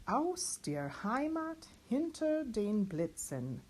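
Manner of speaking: slow, spoken German lyric; teacher speaks through the song lyrics; speaking the song text. Shown as a demonstration. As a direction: speak the song text slowly, not sung. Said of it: "speak the whole song".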